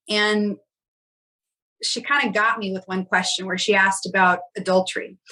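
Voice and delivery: normal talking voice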